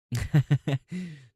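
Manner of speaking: loud, high burst of real laughter; big laugh. Real, not acted. Laughing at a viewer's joke, loud real laugh.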